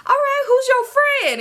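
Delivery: high-pitched, gushing hello to a friend; high mimicked chorus of hellos; excited greeting. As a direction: greet him way up high, mimicking party guests. Acting out people greeting Sam.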